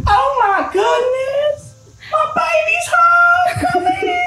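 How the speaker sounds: very high, drawn-out squeal of joy; hammed-up delighted mom, sky-high; overjoyed mother's shriek. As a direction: shriek as your child comes home. Mother greets returning child, acted.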